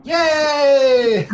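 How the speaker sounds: high, loud drawn-out whoop; gleeful child cheer. Acted. Child learns they are free, high joyful cheer. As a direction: cheer like an overjoyed kid.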